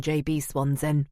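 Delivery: ordinary delivery, nothing marked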